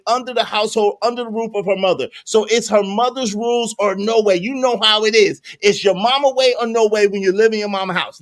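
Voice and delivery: usual speaking voice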